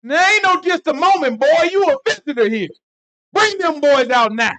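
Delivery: high, loud country holler; angry mob shout. Retold or imitated. The mob shouting down Lot's stalling. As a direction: bark it at him like a threatening crowd. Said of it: "They like".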